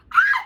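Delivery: short, loud, very high scream; excited shriek. Real, not acted. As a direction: shriek with delight. Screams over the perfume.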